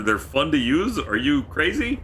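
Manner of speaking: high, slow, rising disbelief; incredulous disbelief. Real, not acted. Streamer reacting to chat calling slide rules fun, incredulous. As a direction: stretch out are you crazy in shock.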